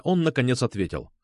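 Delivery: plain reading voice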